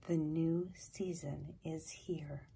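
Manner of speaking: softly whispered, gentle doe; soft bleat. A narrator's reading. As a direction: hush it out like a calm mother deer. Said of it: "she bleated softly".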